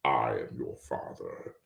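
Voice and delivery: low whispery Vader voice; deep breathy menace, slow; Darth Vader. Shown as a demonstration. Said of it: "find him a little easier with the Force".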